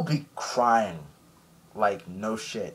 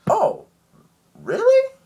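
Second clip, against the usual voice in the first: very high, loud squeal of surprise; mock surprise; 9 dB louder, slower than usual. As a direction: play being caught out, pitch leaping up. Buggy caught out, retold.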